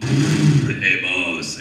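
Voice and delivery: booming, low giant's voice; loud and deep, a big man hailing someone; Andre. Retold or imitated. Giant hailing his boss on set, deep and loud. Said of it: "Hi, Andre".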